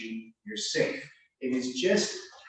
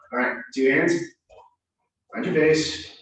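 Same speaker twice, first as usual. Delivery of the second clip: ordinary talk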